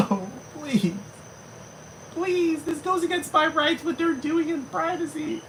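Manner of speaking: high falsetto begging; mock pleading wail. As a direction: plead like a hysterical prude. Acted prude pleading.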